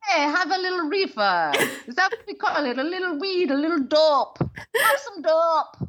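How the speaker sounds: high-pitched, badly faked Jamaican accent. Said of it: "can't even do a Jamaican accent".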